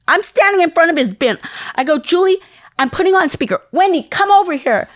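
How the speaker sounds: high, loud excited call to her sister. Retold or imitated. Woman in the record store calling her sister over.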